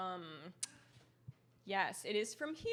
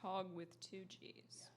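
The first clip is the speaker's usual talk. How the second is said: slow whispered aside; soft slow whisper; 3 semitones lower, 9 dB quieter, slower than usual. Real, not acted. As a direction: whisper it slowly while typing. Whispered spelling note while typing.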